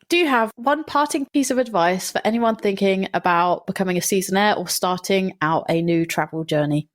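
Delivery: ordinary delivery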